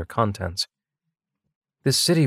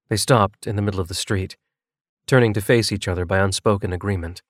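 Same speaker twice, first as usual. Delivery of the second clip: ordinary delivery, nothing marked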